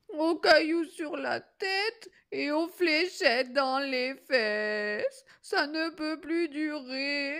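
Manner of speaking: high, drawn-out tearful whining; sobbing wolf. A narrator's reading. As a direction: wail like a hammed-up crybaby. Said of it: "entre deux hoquets".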